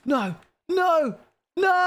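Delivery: high rising panicked no; panicked shout. Real, not acted. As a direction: yell it as all breaks. Circuit breaking.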